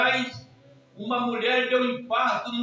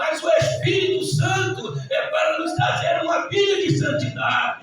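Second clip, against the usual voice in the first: high, loud pulpit proclamation; full-force preaching; 6 semitones higher, 7 dB louder than usual. Real, not acted. Preacher proclaiming Christ's ascension, loud and high. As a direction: proclaim it loudly to the church.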